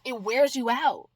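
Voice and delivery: ordinary talk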